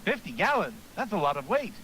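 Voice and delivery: eager gym coach pep talk; hearty coach voice. Acted. Coach voice continues.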